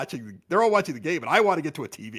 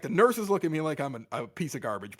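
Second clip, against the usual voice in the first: usual speaking voice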